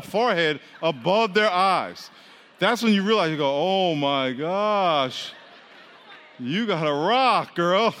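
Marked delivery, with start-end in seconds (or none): 3.5-7.9 drawn-out, hammed-up amazement; mock astonishment. Teasing a friend's big head, mock amazed. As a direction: stretch it slowly in comic disbelief.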